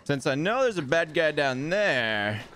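ordinary talk, nothing marked

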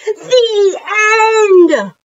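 high, loud, drawn-out finish; announced ending. Shown as a demonstration. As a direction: proclaim the close of the story. Reader announces the end of story.

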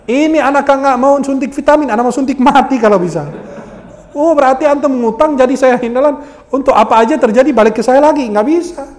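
high-pitched, animated comic rant; mock-outraged scolding. Preacher refusing to be bailing out a borrower, mock-outraged scolding. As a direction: scold it high in exasperated disbelief.

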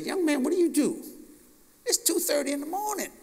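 light imitation of the curious stranger; voicing the stranger. The man questions him.

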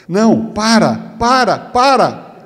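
high, drawn-out mock pleas to stop; mandando parar, encenado. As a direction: stretch each word in a high acted cry. Acting woman crying stop, don't stop. (súplica aguda e arrastada)

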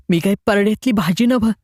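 indignant outburst. Acted. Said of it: "Manjula फणफणत उद्गारली".

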